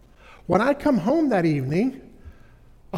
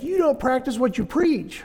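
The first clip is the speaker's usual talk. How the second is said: son accusing; 7 semitones higher than usual. Retold or imitated. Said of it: "He's like".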